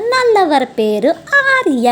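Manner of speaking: ordinary delivery, nothing marked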